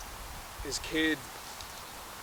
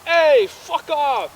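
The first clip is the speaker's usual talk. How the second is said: high, loud, slow shout; road rage yell; 14 semitones higher, 11 dB louder, much slower than usual. Retold or imitated. Dad cutting off three cars, yelling. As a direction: bellow it at the other drivers. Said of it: "He's like".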